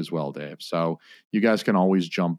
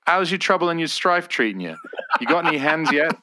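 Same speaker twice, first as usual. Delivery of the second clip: thick Australian; 7 semitones higher than usual. Acted. Host asking his mate about the wife, thick Australian. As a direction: rattle off slang in a thick Aussie accent. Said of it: "thickest, most unintelligible Australian".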